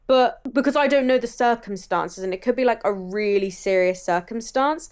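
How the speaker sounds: ordinary talk, nothing marked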